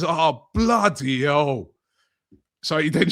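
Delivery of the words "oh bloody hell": drawn-out, slow "oh bloody hell"; slow, put-on dismay; slow mock dismay. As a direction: fake being caught out, very slowly. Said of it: "And he goes".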